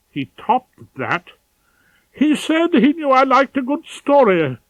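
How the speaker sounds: a prim man appalled retelling a vulgar idea; scandalised pompous head of chambers. Acted. Ballard's voice, scandalised.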